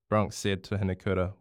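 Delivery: plain reading voice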